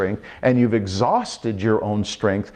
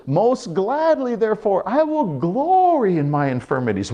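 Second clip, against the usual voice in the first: much higher, glad proclamation; glad rejoicing; 10 semitones higher than usual. Retold or imitated. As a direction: proclaim it with delight. Paul rejoicing, acted.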